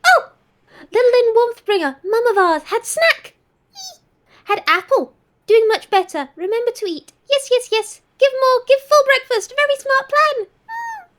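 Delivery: a cheerful little house spirit cooing; chirpy spirit voice praising the tea; high sing-song spirit creature. Acted. Network voice.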